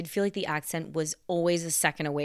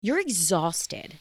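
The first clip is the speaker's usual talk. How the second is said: slow emphatic; much slower than usual. Real, not acted. Stressing how tiring it is.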